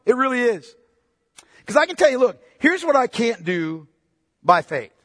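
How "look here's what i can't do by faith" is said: ordinary delivery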